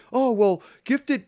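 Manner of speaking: high, loud mock schoolteacher voice; mocking teacher. Mocking his grade-three teacher reassuring pupils, high and loud. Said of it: "teachers ... saying".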